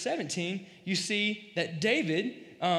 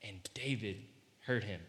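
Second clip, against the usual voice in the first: much softer and lower, each word weighed; "And David heard him" dropped to a hush, slowed for tension; slow, hushed reading; 10 semitones lower, 10 dB quieter than usual. Shown as a demonstration. Preacher slowing the verse to show its tension, hushed. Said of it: "you should slow down".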